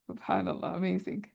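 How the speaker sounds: breathy whisper of amazement; hushed, amazed whisper; whispered awe. Real, not acted. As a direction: breathe it out in quiet wonder. Marveling at author's style.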